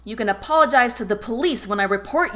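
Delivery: loud furious retort; angry yell. A narrator's reading. Laurel yells back at David, threatening police, loud angry. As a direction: shout it at him. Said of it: "Laurel yelled again".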